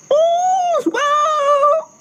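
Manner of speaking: sung very high like a rooster's crow. Acted. Cock on the branch crowing, sung high. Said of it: "he crowed".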